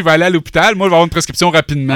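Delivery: mock confidence. American tourist expecting a quick prescription, loud mock confidence. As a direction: play the naive patient, loud and sure of himself.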